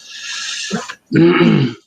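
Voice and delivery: long, high-ended throat clear. Real, not acted. Speaker about to open the talk, throat clearing.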